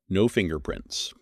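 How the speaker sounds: ordinary delivery, nothing marked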